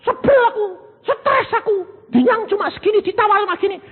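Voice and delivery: high, complaining lady's voice; whiny rich wife. Acted. Rich woman complaining to her husband on the phone, high and whiny. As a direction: whine like a spoiled wife on the phone.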